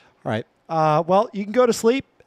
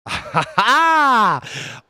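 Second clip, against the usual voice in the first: burst of laughter; 7 semitones higher than usual. Real, not acted. Streamer cracking up at the AI's French, bursting out laughing.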